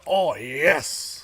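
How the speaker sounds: loud, put-on cartoon villain voice; Wario. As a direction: cheer it out in a cartoon character voice. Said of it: "so we were doing Wario noises".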